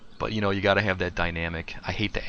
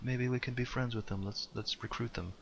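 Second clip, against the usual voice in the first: softer, higher, naive little voice; naive sensitive guy; 4 semitones higher, 7 dB quieter than usual. Retold or imitated. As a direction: play him as a clueless innocent, softly. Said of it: "he's gonna be like".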